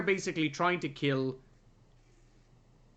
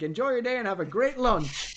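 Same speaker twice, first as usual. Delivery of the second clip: higher put-on cheery farewell; put-on host voice; 6 semitones higher than usual. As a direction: chirp it like a smarmy host. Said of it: "what was it, like".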